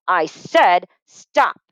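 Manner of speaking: slow, loud, firm command; stern warning. Acted. Mother losing patience at the dinner table, slow loud command. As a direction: lay down the law, word by word. Mother slamming spoon, scolding.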